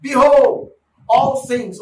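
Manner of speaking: loud, high proclamation; proclaiming. Real, not acted. Preacher booming the verse to the church. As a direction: ring out the verse to the congregation.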